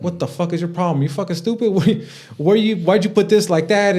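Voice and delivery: a hammed-up young hothead yelling; imitating flipping out. Scene: younger self yelling at people who broke his things, mock rage. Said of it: "I would've fucking flipped out".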